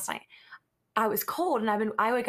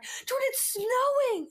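high, drawn-out fake surprise; excited; slower than usual. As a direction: sell the fake snow news. Faking alarm to wake husband.

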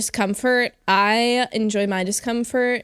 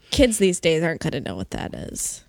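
normal talking voice

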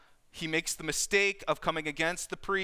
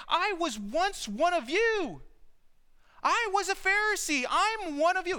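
pleading appeal; 10 semitones higher than usual. Acted. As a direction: call out to them, asking to be taken as one of their own. Paul appeals to Pharisees.

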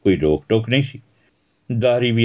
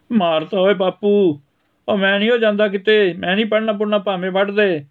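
put-on boy crying out after a beating; 13 semitones higher than usual. Son hit by father's shoe, wailing in protest. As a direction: wail like a kid struck by his father's shoe.

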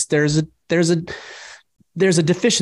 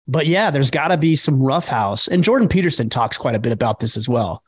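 normal talking voice

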